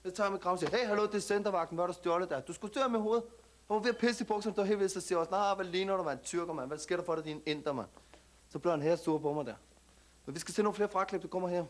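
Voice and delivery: put-on street slang Danish accent, cocky; perkerdansk accent. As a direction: talk like a tough kid from the suburbs, perkerdansk accent. Plays an immigrant youth character. (selvsikker perkerdansk accent)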